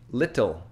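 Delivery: "little" with a crisp released T; over-clear T in "little", no flap; hard T in little. Shown as a demonstration. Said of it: "not pronouncing it normally".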